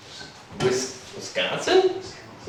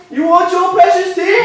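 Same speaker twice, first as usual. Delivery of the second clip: taunting; 6 semitones higher than usual. Acted. Mocking king while dumping tea.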